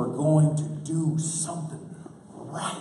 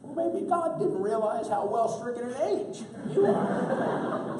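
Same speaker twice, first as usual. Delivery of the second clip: higher, laughing wife comeback; 8 semitones higher than usual. Retold or imitated. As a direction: throw it back as the wife, higher. Wife retorts to husband, quoted.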